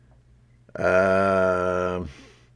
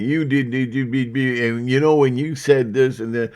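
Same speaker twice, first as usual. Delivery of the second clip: mild mock yell, slightly raised pitch; imitated yelling; 4 semitones higher, 3 dB louder than usual. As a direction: rattle off an imitation of someone complaining. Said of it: "is yelling at me and going".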